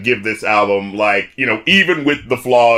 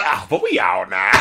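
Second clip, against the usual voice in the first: Southern twang; 5 semitones lower, 4 dB quieter than usual. Retold or imitated. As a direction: lean into a lazy Southern twang. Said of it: "we put this twang on it".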